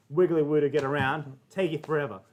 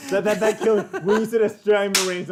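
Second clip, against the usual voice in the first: loud, high laughing speech; cracking up while talking; 5 semitones higher, 7 dB louder than usual. Real, not acted. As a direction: talk through real laughter. Cracking up at the punchline.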